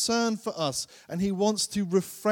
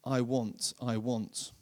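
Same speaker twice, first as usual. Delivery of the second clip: soft mock want; 6 semitones lower, 7 dB quieter, slower than usual. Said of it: "we kind of dress it up".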